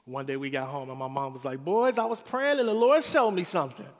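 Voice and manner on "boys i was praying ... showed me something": higher, louder mother announcing a vision; mom's voice. Retold or imitated. Sermon retelling: mother telling her boys, raised louder voice. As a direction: play Mama proclaiming the Lord spoke.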